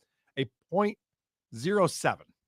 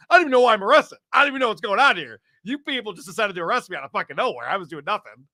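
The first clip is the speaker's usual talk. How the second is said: lightly voiced protesting woman, higher at first; indignant arrestee; 5 semitones higher, 5 dB louder, faster than usual. Arrested woman protesting to officers, mock indignant. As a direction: act out her rushed, indignant protest.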